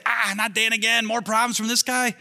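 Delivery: mock exasperation. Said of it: "what does Jesus say?".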